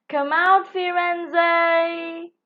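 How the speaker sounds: loud, high call into the bushes; shouting. A narrator's reading. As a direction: call out loudly as if searching. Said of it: "shouted Legs".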